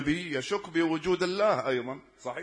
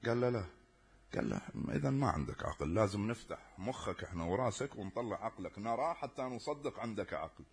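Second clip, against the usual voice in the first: hushed exchange; 4 semitones lower, 10 dB quieter than usual. Retold or imitated. Child and teacher trade quiet lines.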